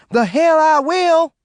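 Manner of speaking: high, slow screeching refusal. Acted. John screeching his refusal at Maureen. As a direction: shriek the refusal. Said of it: "John screeched".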